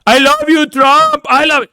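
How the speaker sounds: high, gushing fake adoration; mock a fawning Trump supporter; mock adoring fan. Mocking foreign-accented Trump fans.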